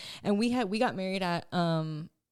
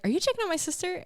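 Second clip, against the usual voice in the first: quick, higher-pitched brother's question; pitched-up, quick mock suspicion; accusing question; 12 semitones higher, faster than usual. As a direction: imitate a brother catching you out. Retelling brother's teasing accusation.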